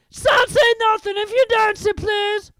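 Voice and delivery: very high, loud sing-song taunt. A narrator's reading. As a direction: lilt it to annoy him. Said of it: "in his annoying sing-song voice".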